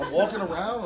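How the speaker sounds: ordinary talk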